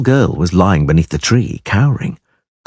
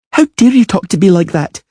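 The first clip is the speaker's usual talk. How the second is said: put-on high, indignant girl's voice; a spoilt child bristling at an insult; haughty girl, much higher; haughty little princess; 11 semitones higher, 3 dB louder than usual. A narrator's reading. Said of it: "lifted her head haughtily".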